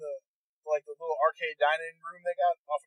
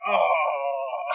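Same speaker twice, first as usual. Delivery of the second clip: crowd groan; 2 semitones lower, 3 dB louder than usual. Retold or imitated. Crowd groaning at each reroll.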